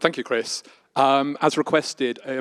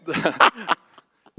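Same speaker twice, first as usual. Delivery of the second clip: laughing; 4 semitones higher than usual. Real, not acted. Laughs mid-sentence at own joke.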